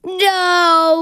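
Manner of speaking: loud put-on silly monster voice; grumpy guitar voice. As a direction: bark it out as a cranky guitar. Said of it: "Guitars, are you excited".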